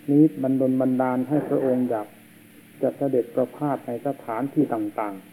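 ordinary talk, nothing marked

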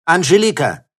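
loud, high call for someone; shout. A narrator's reading. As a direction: shout the name to summon her. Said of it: "крикнул он".

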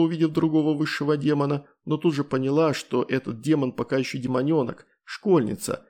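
usual speaking voice

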